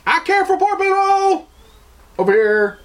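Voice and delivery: hammed-up falsetto show of caring; mocking virtue signaler. Mocking people who post about caring, high falsetto parody. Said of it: "the instant response of everybody is".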